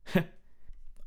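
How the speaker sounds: brief acted chuckle; a single puff of laughter; short laugh. A narrator's reading. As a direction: let out one short laugh. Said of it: "Dorian Gray laughed".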